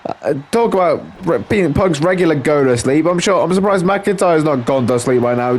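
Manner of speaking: ordinary talk, nothing marked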